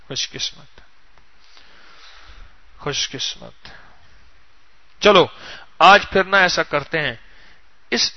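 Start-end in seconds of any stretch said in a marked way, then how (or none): 0.1-3.7 very soft, low, near-whispered repetition; almost whisper it, savouring the words; hushed wonder. Real, not acted. Preacher tells listeners of their good fortune, hushed and low.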